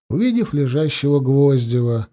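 usual speaking voice

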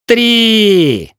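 high, loud sharp shout; shouted count. A narrator's reading. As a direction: fire off the word. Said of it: "выкрикнул Тиль де Ламусон".

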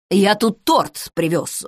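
ordinary delivery, nothing marked

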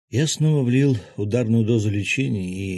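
plain reading voice

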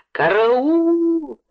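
high, drawn-out call for help; loud wail. A narrator's reading. Woman calls the yard for help, high wail. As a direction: howl it like a siren, stretched. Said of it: "голосом она завопила".

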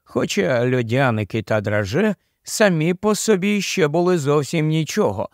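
ordinary delivery, nothing marked

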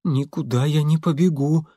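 plain reading voice

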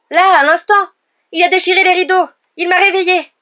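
high, loud panicked insistence; terrified shouting. A narrator's reading. Ron tells roommates of the intruder, terrified shout. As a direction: cry it out in terror. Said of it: "une expression d'intense terreur".

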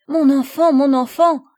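high, loud cry of a terrified mother; mother crying out in terror; frightened cry. Acted. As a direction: cry out at the sight of her soaked child. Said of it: "s'écria madame de Rosebourg".

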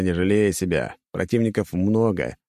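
ordinary delivery, nothing marked

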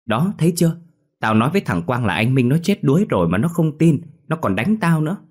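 put-on high, loud childish complaining; a boy complaining to his friend, high tattling whine; whiny tattling kid. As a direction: tattle with injured pride. Lì vindicated, childish.

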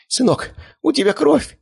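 very high, drawn-out wail; shrill-pitched acted female alarm; alarmed mother's cry. A narrator's reading. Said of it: "вскрикнула дамочка".

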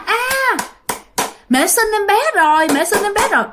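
put-on high, bright childish announcement; a little kid blurting out happy news, high up; excited child cry. Little child announcing a new sibling, high excited cry. Small child thinks baby was born.

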